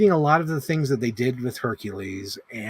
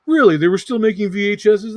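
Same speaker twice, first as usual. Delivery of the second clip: higher, louder incredulous stranger voice; mock doubting commenter; 7 semitones higher, 6 dB louder than usual. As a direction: play the skeptical Twitter user, mocking. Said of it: "someone on Twitter asked me".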